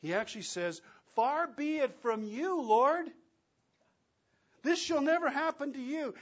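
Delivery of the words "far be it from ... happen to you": loud, high, pleading objection; passionate protest. Acted. Peter objecting to Jesus, loud and passionate. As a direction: protest with all your heart.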